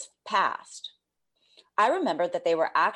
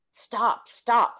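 rising, louder urgent stop; calling out stop; 5 semitones higher, 4 dB louder than usual. A narrator's reading. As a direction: call out to stop yourself. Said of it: "called out the observer me".

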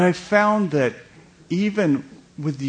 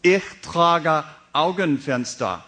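put-on confident American-accented German; proud bad German; 2 semitones higher than usual. Comedian retelling his clumsy German, confident American accent. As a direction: announce it like it's correct. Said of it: "So I would say".

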